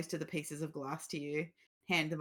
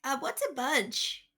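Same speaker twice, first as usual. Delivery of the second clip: puzzled acolyte; 7 semitones higher, slower than usual. Acted. GM voices acolyte.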